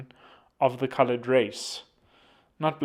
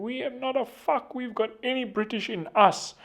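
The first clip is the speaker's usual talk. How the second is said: mock aunt insisting, much higher; 11 semitones higher than usual. Aunt denying any British ancestry, high mock indignation. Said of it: "And she was saying".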